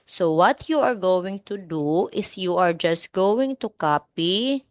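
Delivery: ordinary talk, nothing marked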